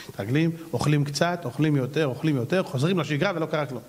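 usual speaking voice

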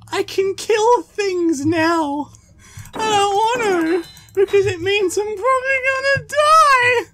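high, slow panicked wail; panicked whine. Real, not acted. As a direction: whimper it out in a frantic high voice. Panics about having a weapon.

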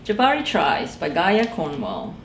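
plain reading voice

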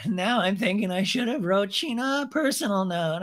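high-pitched mocking impression; mock ice-cold girl. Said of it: "the cold ice school girl".

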